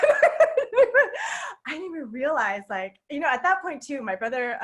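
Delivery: ordinary talk, nothing marked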